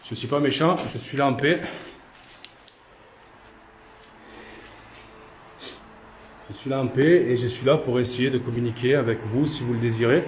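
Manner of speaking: loud, measured address to unseen spirits; calling to spirits. Real, not acted. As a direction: call out peaceably to whoever is there. S'adresse aux esprits à voix haute. (appel aux esprits à voix forte)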